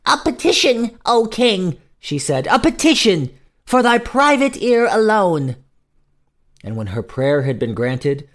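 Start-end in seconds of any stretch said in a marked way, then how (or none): 0.1-1.8 high, raised plea to a monarch; old woman petitioner. A narrator's reading. Old woman to the king, high pleading. 2.5-5.6 high, loud, ringing plea; old woman petitioner. A narrator's reading. Old woman begging a private audience, high insistent plea.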